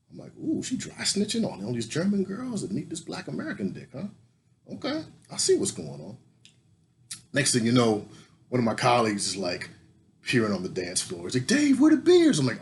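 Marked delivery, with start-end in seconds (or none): 0.4-6.2 teasing realization. Retold or imitated. 11.5-12.4 high and loud, calling across the floor. Retold or imitated. Colleague calls from afar.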